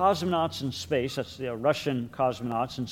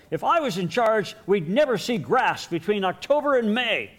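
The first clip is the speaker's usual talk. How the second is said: evil Calvin; 8 semitones higher, 6 dB louder than usual. Pretend. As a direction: kid voice pitched higher and louder, scheming. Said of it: "has that evil look and says".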